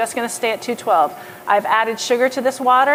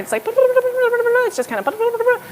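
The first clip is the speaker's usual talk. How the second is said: high, slow, drawn-out mimic of a simmering pot; playful boiling-water imitation; imitating boiling water; 14 semitones higher, much slower than usual. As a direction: stretch it high like bubbling syrup. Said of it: "it just sounds like water boiling".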